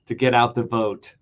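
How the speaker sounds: normal talking voice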